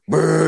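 loud, high raspberry-like fart sound; imitated fart noise. Storyteller mimics his fart at McDonald's, loud blurted noise.

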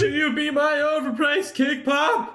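high, hammed-up proposal voice; mock proposal. Pretending to propose with menu items, high hammed-up voice. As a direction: propose to a scone, overdone.

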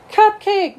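high, loud burst of cupcake excitement; excited. Acted. Max spots the cupcake Keisha holds out, excited high exclamation. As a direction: pipe up high, thrilled at the treat.

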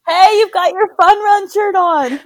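high, loud, delighted exclamation; bursting out with delight; excited. Real, not acted. Noticing shirt, excited call.

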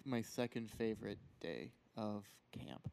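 ordinary delivery

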